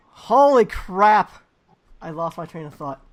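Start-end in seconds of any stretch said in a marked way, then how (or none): 0.1-1.4 startled, drawn-out holy crap; surprised exclaim. Real, not acted. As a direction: blurt it out, caught off guard. Loses place in outro.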